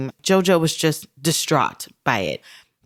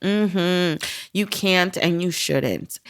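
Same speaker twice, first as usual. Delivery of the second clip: usual speaking voice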